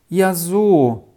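mildly crestfallen realisation; slightly disappointed. A narrator's reading. Rolf learns the right answer from Jul, a bit disappointed. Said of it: "sagte Rolf etwas enttäuscht".

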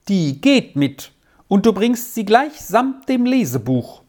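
firm command. A narrator's reading. Malva insists the grammar goes along, firm command. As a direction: overrule the child firmly. Governess firmly overrules the child.

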